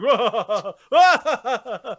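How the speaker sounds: mock villain cackle; a hammy evil laugh; sarcastic evil laugh. Real, not acted. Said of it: "How to do an evil laugh?".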